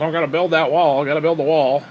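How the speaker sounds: lightly put-on wall-building zealot; mildly mocking impression of a border hardliner; mocking evangelical. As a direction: parody a chanting evangelical, ending in fake laughter. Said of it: "as evangelical".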